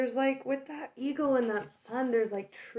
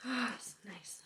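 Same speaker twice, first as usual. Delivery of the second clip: contented whisper; 11 dB quieter than usual. Real, not acted. Snuggling into a warm sweater.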